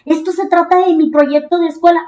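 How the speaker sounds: high, pleading angry protest; upset complaint. Retold or imitated. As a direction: scold a coworker who betrayed you. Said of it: "Salgo del estudio bien enojada".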